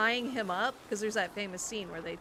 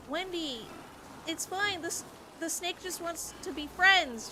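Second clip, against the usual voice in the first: put-on higher, coaxing the scared girl; coaxing friends; 7 semitones higher than usual. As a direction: talk her down gently. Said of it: "everybody's like".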